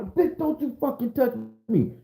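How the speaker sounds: high-pitched acted tirade; laying into everybody. Defendant cursing out the whole courtroom, acted rage. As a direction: rattle off curses with the voice way up. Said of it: "I'm laying into everybody in the courtroom".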